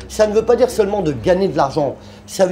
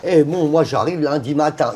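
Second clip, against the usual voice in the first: usual speaking voice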